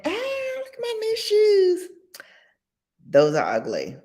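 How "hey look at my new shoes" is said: very high, sing-song showing off; excited girlfriend. Retold or imitated. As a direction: show off new shoes proudly. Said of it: "you coming in".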